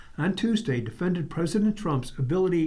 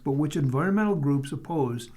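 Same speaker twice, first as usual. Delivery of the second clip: ordinary talk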